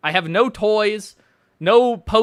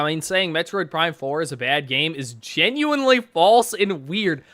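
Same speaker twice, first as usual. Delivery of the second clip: ordinary talk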